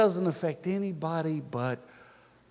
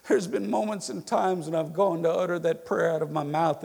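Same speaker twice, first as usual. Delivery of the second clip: ordinary talk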